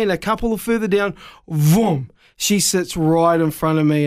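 normal talking voice

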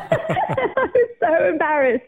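laughing through the words, high and slow; drawn out on a laugh; embarrassed laughter. Real, not acted. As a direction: laugh at your own embarrassment. Laughing at her retold embarrassment.

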